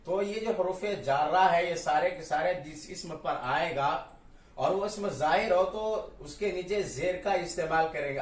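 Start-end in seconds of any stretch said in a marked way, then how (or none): none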